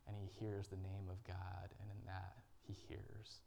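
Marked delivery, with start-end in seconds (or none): 1.8-3.4 slow whisper; hushed, slow whisper of peace. Acted. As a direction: breathe it out slowly. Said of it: "he hears this gentle whisper".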